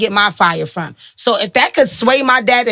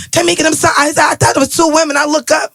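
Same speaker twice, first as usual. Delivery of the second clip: put-on lightly done Jamaican accent, pitched higher; 5 semitones higher than usual. Daughter quoting her Jamaican father to co-host, light Jamaican accent. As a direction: imitate dad apologizing in a Jamaican accent. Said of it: "with his Jamaican accent".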